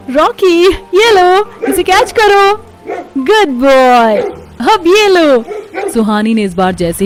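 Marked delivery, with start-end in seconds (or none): 0.1-5.4 high, bright-voiced girl calling her dog, stretched; playful call to dog. Girl playing fetch with her dog Rocky, high playful calls. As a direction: coax the dog to fetch, sing-song.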